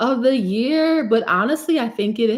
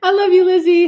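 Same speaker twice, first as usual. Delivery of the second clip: higher and louder, through a laugh; 10 semitones higher, 8 dB louder than usual. Real, not acted. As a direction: laugh out your love for the commenter. Laughing thanks to a commenter.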